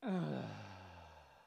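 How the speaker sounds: long sigh with a strong start, falling away; drawn-out falling sigh; letting-go sigh. Shown as a demonstration. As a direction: release a big audible exhale. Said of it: "let it go".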